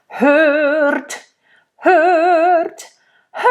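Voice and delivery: sung hurt with a rolled R, rising; rolled R in rage. Shown as a demonstration. Teacher shows the rolled R for a raging queen. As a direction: sing hurt rolling the R in rage. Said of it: "it's okay here to roll the R".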